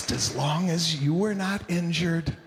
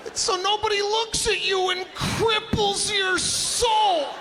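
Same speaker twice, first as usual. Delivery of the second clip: big incredulous stand-up shout; shouting rant; 14 semitones higher than usual. Real, not acted. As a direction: yell it at the crowd in disbelief. Comic rant to audience.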